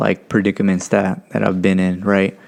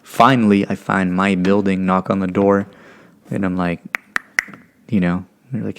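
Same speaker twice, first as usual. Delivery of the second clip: usual speaking voice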